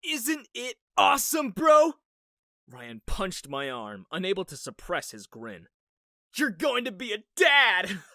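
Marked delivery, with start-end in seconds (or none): 0.0-1.9 loud, high bro cheer; excited shout. Acted. Ryan cheering brother on. 6.4-8.0 loud, high happy yell; excited shout. Acted. Ryan cheering brother on.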